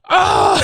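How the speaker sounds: mock scream. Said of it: "he's like".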